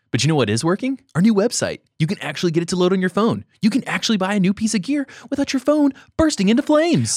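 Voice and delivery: hyped ad pitch. Pretend. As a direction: sell it like a TV ad. Ad bit for new website.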